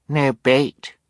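put-on high, loud little-girl voice; small child's voice. A narrator's reading. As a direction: pipe up like the youngest sibling. Youngest child's voice in story.

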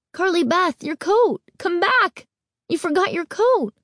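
high frantic calling; frantic cries. A narrator's reading. Friend calling from doorway, high frantic cries. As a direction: shout after her from the doorway. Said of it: "Sabrina's frantic cries".

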